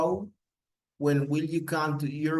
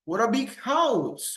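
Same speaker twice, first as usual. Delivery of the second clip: high, swooping exclamation; exaggerated admiration; 8 semitones higher than usual. Shown as a demonstration. Teacher models admiring phrases for students, exaggerated amazement. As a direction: marvel hugely, pitch soaring then falling on house. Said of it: "I am exaggerating something".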